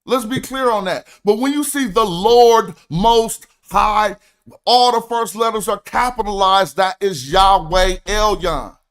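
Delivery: ordinary talk, nothing marked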